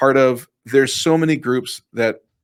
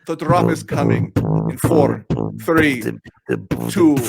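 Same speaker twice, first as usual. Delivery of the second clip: Russian dance DJ. Pretend. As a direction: hype DJ drop countdown. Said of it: "like a Russian dance D J".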